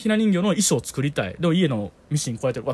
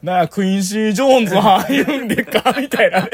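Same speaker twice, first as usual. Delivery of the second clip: hammed-up Osaka dialect, higher, louder and laughing; joking Osaka dialect; 6 semitones higher, 6 dB louder than usual. Friend jokes about a radio show where everyone speaks Osaka dialect, laughing mock Osaka dialect. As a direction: mimic a radio host making a foreign star talk in Osaka dialect. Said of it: "全員大阪弁になるっていう".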